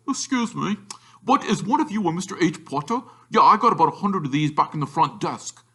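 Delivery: high, chatty innkeeper voice; hotel owner. A narrator's reading.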